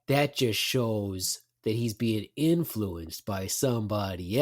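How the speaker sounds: slow, low, pointed hinting; retold insinuation. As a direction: act out a knowing hint, dragging it out. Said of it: "And this is what I said".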